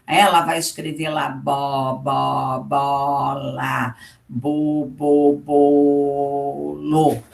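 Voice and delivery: low, slow, syllable by syllable: bo, bo, bola; sounding out syllables. Shown as a demonstration. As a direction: sound out bo, bola like a child spelling. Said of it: "ela já pronuncia". (soletrando bo, bola como uma criança)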